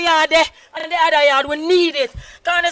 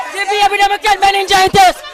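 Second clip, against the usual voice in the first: loud, higher protest shout; shouted plea; 4 semitones higher, 5 dB louder than usual. Real, not acted. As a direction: raise the voice demanding justice. Flood victim pleading for help.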